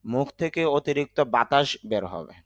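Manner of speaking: ordinary talk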